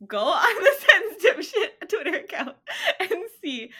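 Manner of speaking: high, loud, laughing through words; cracking up while gushing. Real, not acted. Gushing about funny posts.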